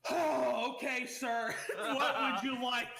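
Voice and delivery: weary drive-thru worker. Retold or imitated. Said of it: "a loud as fuck sigh, like".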